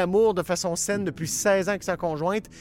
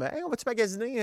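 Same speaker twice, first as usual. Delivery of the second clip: mock cheery invite; 8 semitones higher than usual. Acting out a cousin's invitation.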